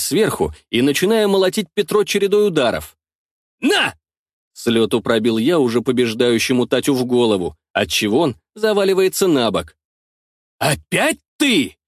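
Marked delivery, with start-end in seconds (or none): 3.6-3.9 shout. A narrator's reading. Strike with a shout. 10.6-11.8 snarl. A narrator's reading. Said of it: "Вызверился я на Тараса".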